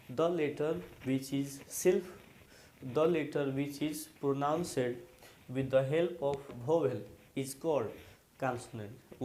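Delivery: very slow, each English word spaced out for the class; slow dictation. Shown as a demonstration. Teacher dictating the definition to students, very slow and spaced. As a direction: read the English definition slowly so students can copy it. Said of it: "फिर से सुनिए".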